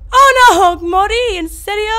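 high, loud shriek of disbelief; panicked scream. Real, not acted. Gamer shrieking after dying to the monster, panicked and loud. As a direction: scream as if you just died. Player dies in horror game.

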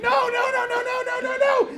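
high, squeaky panicked wail; panicked no's. Retold or imitated. Driver panicking at the smoke, high squeaky wail. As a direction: wail it as the car starts smoking. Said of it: "I'm like".

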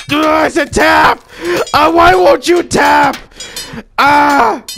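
loud, high exasperated shouting; frustrated yelling. Real, not acted. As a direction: yell at a game that won't obey.

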